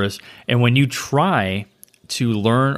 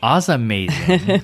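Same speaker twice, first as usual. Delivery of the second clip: playful echo; 4 semitones higher, slower than usual. Acted. Playful repeat of event name.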